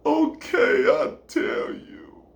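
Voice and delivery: high, hammed-up tearful voice; mock sobbing. Woman about to confess to him, theatrically sobbing. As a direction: cry through the words, slowing at the end.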